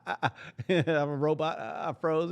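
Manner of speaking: awkward forced-cheer cover-up; brushing it off. Retold or imitated. Boy covering his hurt in front of class, awkward. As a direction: pretend it's fine with a nervous grin. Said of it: "So I'm like".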